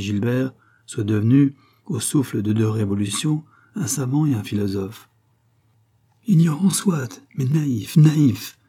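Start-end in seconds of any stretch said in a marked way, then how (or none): 6.3-8.6 indignant outburst, pitched up and louder; furious. A narrator's reading. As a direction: cry it out in offended anger. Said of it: "s'écria furieusement la reine".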